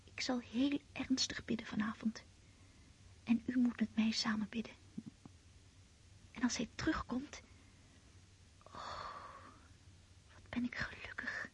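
hushed bedtime whisper; night whispers. Acted. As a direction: whisper so nobody wakes.

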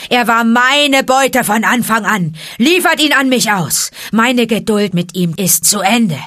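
loud, high angry tirade building to a shout; roaring. A narrator's reading. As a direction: roar your demand at the pack. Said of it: "Da brüllte Shere Khan". (wütend gebrüllt, sich immer weiter steigernd)

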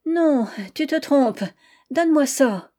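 snatch it back, pleading; wife desperate to take the coat back; urgent plea. Acted.

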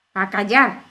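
vocifera mucho más fuerte y más agudo; grito furioso. Acted. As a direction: ordena silencio a gritos. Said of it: "vociferó la reina". (la reina furiosa vocifera a Alicia, a gritos)

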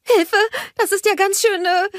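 very high, loud squeal; Anna squealing at the hot sand. A narrator's reading. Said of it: "quietschte sie".

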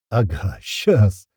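very slow, stretched-out scoff; mocking amusement. A narrator's reading. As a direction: draw out the sarcastic refusal. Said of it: "засмеялся уже я".

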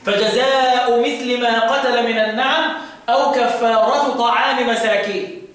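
slow recitation, "فجزاء" and "كفارة" ending in plain -u; no tanween, khafd. Shown as a demonstration. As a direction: chant with no final n on "كفارة", "طعام" ending in i. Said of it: "في الموضعين بدون تنوين وخفض".

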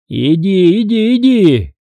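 rising-pitched repeated order; commanding. A narrator's reading. Father orders son out of the room, commanding. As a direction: shoo him out insistently. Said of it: "приказал отец".

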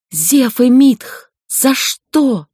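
high, slow, loud pained cry; anguished wail. A narrator's reading. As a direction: wail it out in agony. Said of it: "Полный боли вопль королевы".

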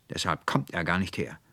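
ordinary delivery, nothing marked

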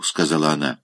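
usual speaking voice

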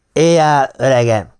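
put-on loud, slurred drunken cry; drunken stammer. A narrator's reading. Drunk Ellen slurs at the narrator, loud. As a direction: blurt it out drunk.

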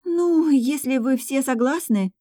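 slow, high, wistful drawl; glum agreement. A narrator's reading. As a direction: give in reluctantly, dragging the words. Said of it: "тоскливо сказала Голия". (тоскливо, протяжно)

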